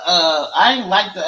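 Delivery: normal talking voice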